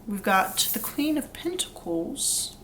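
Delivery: put-on slow, drawn-out light British accent on Queen; slight British accent. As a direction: drawl Queen of Pentacles in a hint of British accent. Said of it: "I don't know why I said it like that".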